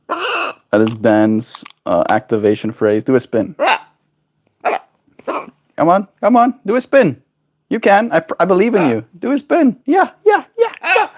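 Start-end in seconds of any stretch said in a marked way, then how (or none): 5.7-11.1 much higher, rising cheer; high encouraging coax. Real, not acted. Owner urges her bird to do a spin, high cheering.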